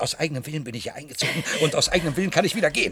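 fast words tumbling out through a laugh; giddy, reckless, racing; laughing rush. Acted. Laughing in a fast rush while breaking free.

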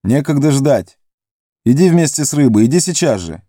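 high, urgent command; urgent shout. Acted. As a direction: hurry him up. Фродо торопит. (Фродо торопит Горлума, громко и резко)

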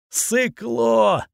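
high, drawn-out jeer; jeering call. A narrator's reading. Torch to a mutant fleeing into the woods, jeering call. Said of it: "выкрикнул ему вслед факел".

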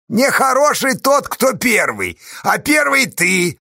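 high, loud retort; theatrical high-pitched accusation; accusing shout. A narrator's reading. Villain shouts back accusingly at rival.

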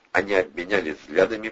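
ordinary delivery

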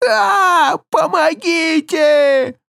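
very high drawn-out scream; screaming for help. Acted. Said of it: "снова заорал мужик".

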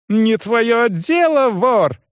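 high, slow, forceful outburst; angry rebuff. A narrator's reading. Young man rebuffs prying manager, angry. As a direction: shut him down furiously.

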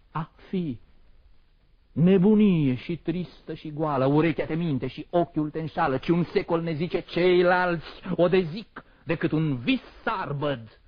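loud, high-pitched, impassioned declamation; anguished tirade. Acted. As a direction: rail against existence at full voice. Poet denounces existence as madness.

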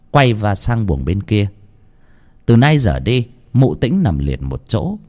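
ordinary delivery, nothing marked

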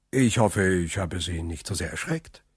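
soft, low, gentle reassurance; calm them down, sinking in pitch and volume; soothing. A narrator's reading. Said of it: "sagte er besänftigend". (besänftigend, leise und tief)

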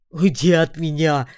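loud, terrified scream; scared orc screaming; frightened yell. A narrator's reading. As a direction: shriek to get her away. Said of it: "испуганно заорал орк".

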